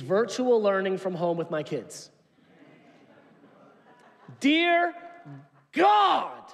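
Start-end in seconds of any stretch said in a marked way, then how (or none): 4.4-6.4 loud, high plea to heaven; exasperated cry. Real, not acted. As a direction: throw it up to heaven in despair. Exasperated cry about kids' schooling.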